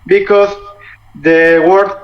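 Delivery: ordinary delivery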